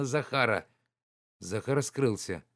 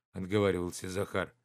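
ordinary delivery

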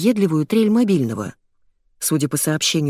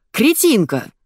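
high-pitched angry insult; acted furious outburst; raging; 6 semitones higher, 3 dB louder, slower than usual. A narrator's reading. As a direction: spit out abuse in a rage. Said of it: "бушевал Добров".

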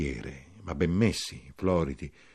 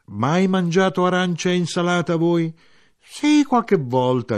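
voiced dialogue; 11 semitones higher, 8 dB louder than usual. Dialogo tra narratore e siciliano.

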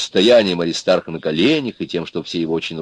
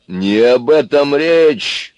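loud, higher, drawn-out interruption; cutting in; 7 semitones higher, 6 dB louder, much slower than usual. A narrator's reading. Брюхатый stops Аристарх's excuses, cutting in. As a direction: cut him short, leaning slowly on each word. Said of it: "прервал его Брюхатый".

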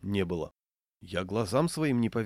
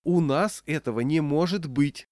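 raised, emphatic public declaration; loud repetition; 6 semitones higher, 4 dB louder than usual. A narrator's reading. Manageress turns to the other diners, loud repetition. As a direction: announce it firmly to the whole room. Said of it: "громко повторила".

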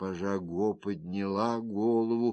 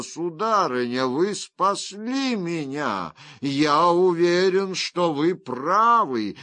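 louder, higher, fervent gratitude; exultant judge, voice raised; elated exclamation; 5 semitones higher, 4 dB louder than usual. Acted. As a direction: burst out with relieved conviction.

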